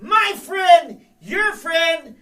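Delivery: announcing. Acted. Host introduces the DJ loudly.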